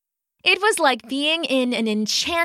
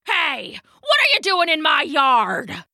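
higher, indignant shout; angry challenge; 4 semitones higher than usual. A narrator's reading. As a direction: confront a trespasser, pitched up. Big angry dog confronts intruding bunny.

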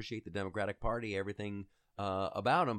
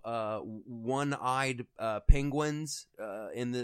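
normal talking voice

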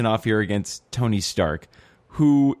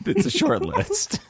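laughing. Real, not acted. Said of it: "How dare you".

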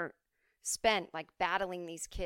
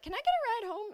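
much higher, sweet ask; hopeful child; 4 dB louder than usual. Retold or imitated. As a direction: beg like a small kid. Child asking cop for ride.

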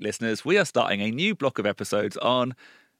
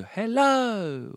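own plain hello; 13 semitones higher than usual. Retold or imitated. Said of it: "I usually just go".

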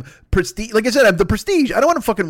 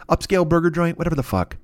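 ordinary talk, nothing marked

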